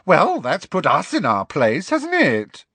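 high, loud sarcastic creature; high-pitched gargoyle. A narrator's reading. Second gargoyle mocking pupil at the door, high-pitched. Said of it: "in a high-pitched voice".

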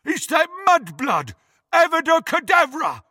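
high, loud shouted curse; furious shout. A narrator's reading. Crabbe aims to kill Hermione, shouting furiously. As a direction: scream the killing spell with hate.

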